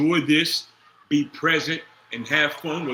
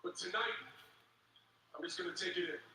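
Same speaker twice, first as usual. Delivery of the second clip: hushed aside; 3 semitones higher, 15 dB quieter, faster than usual. Real, not acted. Quiet aside.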